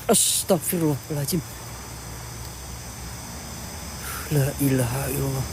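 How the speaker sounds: soft, low whispered prayer; hushed prayer. Real, not acted. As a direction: breathe the prayer out quietly. Sudden hushed prayer after fright.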